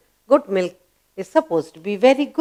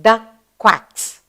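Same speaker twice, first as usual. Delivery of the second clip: ordinary talk, nothing marked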